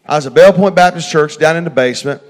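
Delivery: ordinary delivery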